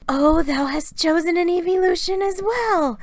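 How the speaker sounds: Sarah exclaiming over her sister's choice. A narrator's reading. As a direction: exclaim with happy surprise. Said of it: "Sarah exclaimed".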